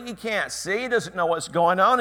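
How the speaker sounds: normal talking voice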